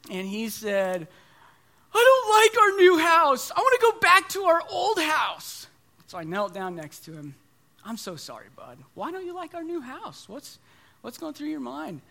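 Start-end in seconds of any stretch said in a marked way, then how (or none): 1.9-5.7 put-on high, loud little-kid whine; upset toddler. Little son telling dad he wants the old house, whining high and loud. 7.8-12.0 soft comfort. Retold or imitated. Father kneeling by crying son.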